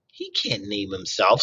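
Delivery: slow goose squawk; slowly squawking old goose; Loudwing squawk. Acted. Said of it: "squawked Loudwing".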